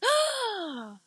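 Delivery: high, drawn-out mock gasp; shocked gasp. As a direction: gasp in hammed-up shock at who it is. Acts her shock at seeing him.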